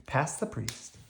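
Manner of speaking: usual speaking voice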